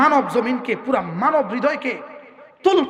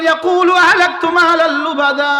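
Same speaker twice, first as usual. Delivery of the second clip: slow, chanted recitation, louder and higher; Quranic recitation; 4 semitones higher, 5 dB louder than usual. Acted. Preacher to congregation, reciting the verse in slow chant. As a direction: draw out the Arabic verse in melodic tone.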